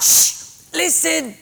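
sharp shush, high insistent word; urgent hushing. Retold or imitated. Patient interrupting the assistant's question, urgent hushing. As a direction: hush them and make them listen.

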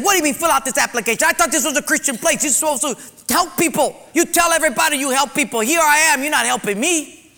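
high, fast accusing rant; furious yelling. Retold or imitated. Man yelling at pastor.

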